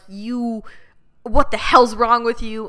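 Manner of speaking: loud angry yell. Retold or imitated. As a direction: yell at her angrily. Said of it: "it's like".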